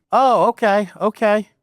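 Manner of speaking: lightly voiced older stranger calling out high. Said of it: "it sounded like an older person".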